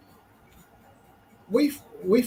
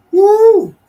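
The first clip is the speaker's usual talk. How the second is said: excited whoop; 12 dB louder than usual. Real, not acted. Cheering about the wild night.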